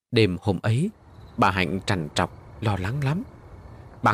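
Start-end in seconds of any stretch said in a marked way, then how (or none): none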